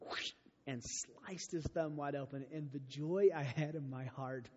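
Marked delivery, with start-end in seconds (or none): none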